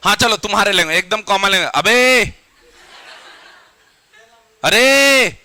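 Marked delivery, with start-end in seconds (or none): 1.7-5.4 loud high shout, वाले अरे dragged long; calling out. Shown as a demonstration. Teacher showing how friends call each other, long shout. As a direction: yell across the street to a friend. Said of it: "ऐसा चिल्लाते हैं ना".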